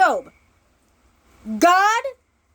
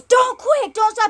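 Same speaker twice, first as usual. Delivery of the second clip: urgent coach; 6 semitones higher than usual. Retold or imitated. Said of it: "and he goes".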